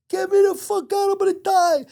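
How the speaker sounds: high-pitched mock desperate wail; panicked plea. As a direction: imitate a trapped man crying out, very high pitched. Trapped man pleading, acted in story.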